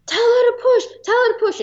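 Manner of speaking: high, loud urgent command; mock frantic dispatcher; urgent order. Ambulance operator urging push.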